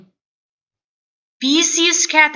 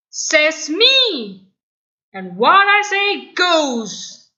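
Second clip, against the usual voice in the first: higher, loud, slow bully declaration; domineering bully; 7 semitones higher, 5 dB louder, slower than usual. Acted. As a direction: lay down the law slowly. Tyron asserting power.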